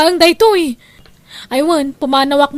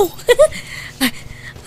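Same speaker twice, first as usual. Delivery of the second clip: a breathy, gloating thief's cackle, higher; gleeful breathy cackle; 9 semitones higher, 4 dB quieter than usual. Acted.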